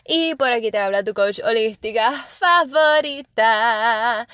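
loud, upbeat show opener with drawn-out words; podcast intro. Acted. Holistic coach welcomes listeners to the podcast, loud sing-song intro. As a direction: greet the listeners big, stretching the show name and sign-off.

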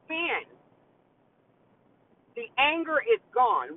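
ordinary talk

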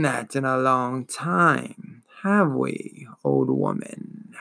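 slow, dragging, wheezing ancient voice; wheezing old turtle. Acted. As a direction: drawl it out like a weary old beast. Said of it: "wheezing, gurgling voice".

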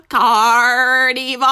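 low, slow, drawn-out bleat; sheep shouting. A narrator's reading. Sheep shouting from their field, low slow bleat. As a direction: bleat the word out slowly. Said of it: "I hears them shouting".

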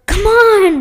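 loud, high urgent hiss; too-loud whisper. A narrator's reading. Skylar in Stella's ear, loud would-be whisper. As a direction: urge them on far too loudly. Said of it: "whispered Skylar in my ear".

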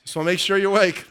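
ordinary delivery

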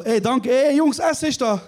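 high, loud cheerful call to the flatmates; mock excited yell to the boys. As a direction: shout happily that the food is here. Thanking delivery guy, calling flatmates.

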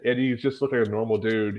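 ordinary talk, nothing marked

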